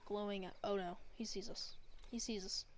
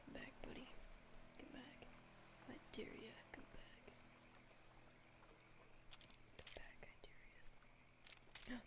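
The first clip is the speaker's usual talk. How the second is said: soft teasing whisper; taunting whisper; 4 semitones higher, 13 dB quieter, slower than usual. Real, not acted. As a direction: dare it under your breath.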